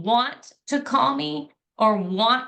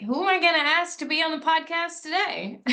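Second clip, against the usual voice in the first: light, raised carefree musing; playful inner voice; 7 semitones higher than usual. Retold or imitated. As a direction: toss off a breezy thought. Retold thought, playful quote.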